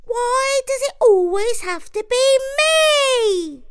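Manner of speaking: very high, loud complaining mouse; Jimmy whining. A narrator's reading. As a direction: plead against it. Jimmy protests.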